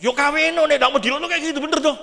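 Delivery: loud, high indignant retort; snapping back angrily. Retold or imitated. Woman asked why she never married, loud indignant snap. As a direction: hit back sharply, offended. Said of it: "Langsung melekus".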